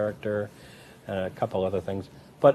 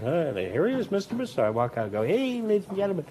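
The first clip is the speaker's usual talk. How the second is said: showman intro; 9 semitones higher than usual. Retold or imitated. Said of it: "I'm kinda going".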